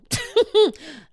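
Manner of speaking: loud burst of laughter, falling; big laugh. Real, not acted. Laughing at the idea of climbing wet rocks, loud burst.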